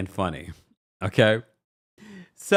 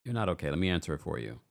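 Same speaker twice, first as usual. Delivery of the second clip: ordinary delivery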